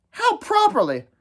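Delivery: put-on high-pitched boyish questioning; puzzled query. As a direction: ask it in surprise. Ralph questions Maurice's idea.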